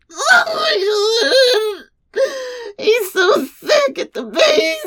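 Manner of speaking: high, drawn-out moan. Acted. Wife on the phone to her husband, moaning. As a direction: let the words stretch into a high moan. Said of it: "that's why I was moaning".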